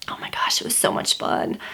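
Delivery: hushed, breathy gush of delight; excited whisper. Real, not acted. Vlogger gushing to her viewers in an excited whisper. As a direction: whisper it like a happy secret. Gushing about the baby store.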